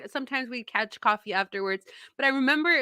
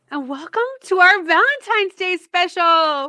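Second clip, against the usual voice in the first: high, loud, excited show welcome; excited announcement; 9 semitones higher, 8 dB louder than usual. Real, not acted. Host welcomes listeners to Valentine's special, excited. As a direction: announce it with big party energy.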